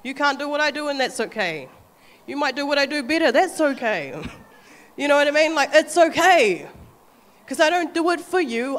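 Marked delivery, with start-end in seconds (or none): none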